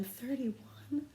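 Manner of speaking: rising, high fretful count; a flustered teacher recounting her desks. Acted. Teacher fretting to herself about the crowded room, high and rising. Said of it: "kept mumbling over her double chin".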